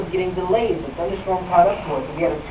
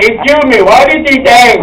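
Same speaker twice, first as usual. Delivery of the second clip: loud, higher outraged demand; indignant challenge; 5 semitones higher, 12 dB louder, slower than usual. Retold or imitated. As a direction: call her out so others hear. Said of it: "I'm like".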